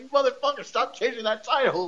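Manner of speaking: high, giggling fake outrage; laughing mock curse. Fan laughing while mock-cursing a wrestler over the title. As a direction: scold him through laughter. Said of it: "I was like, I was like".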